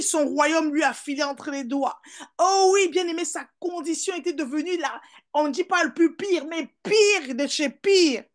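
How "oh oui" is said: loud, high exclamation; preaching refrain. Real, not acted. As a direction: launch the phrase like a preacher's refrain. (refrain de prédicateur, fort et aigu)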